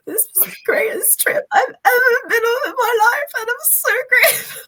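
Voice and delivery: high, loud, crying through the words; thanking dad on the plane home, voice breaking with tears; tearful gratitude. Retold or imitated. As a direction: choke up with overwhelming gratitude. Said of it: "literally broke down".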